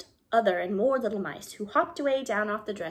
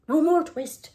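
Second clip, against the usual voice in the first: higher, louder fevered cry of no more twist; feverish cry; 7 semitones higher, 7 dB louder, slower than usual. Acted. Sick tailor crying out in his dreams, high and loud. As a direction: raise the repeat to a fevered cry.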